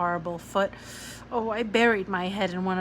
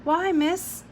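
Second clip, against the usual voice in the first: put-on puzzled child; 10 semitones higher, 5 dB louder than usual.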